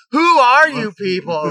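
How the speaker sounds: cartoon star bewildered by eyeballs under his house; Patrick's bewildered cry. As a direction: Patrick startled by strangers under his rock. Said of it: "he's like".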